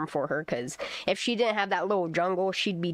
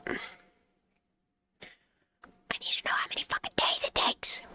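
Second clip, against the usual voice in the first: whisper. Real, not acted. Frustrated muttering to self.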